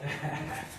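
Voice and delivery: breathy teary laugh; a soft shaky breath out; shaky sigh. Real, not acted. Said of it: "trying not to cry".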